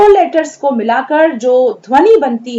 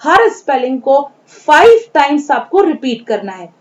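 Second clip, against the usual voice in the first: ordinary talk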